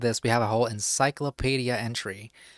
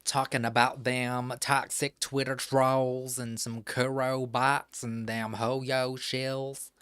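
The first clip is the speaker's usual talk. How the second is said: put-on Southern accent. Streamer mocks fandom drama for chat in a hammed Southern accent. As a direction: play a fed-up Southern old-timer in a Southern accent. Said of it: "Love me some Southern accent".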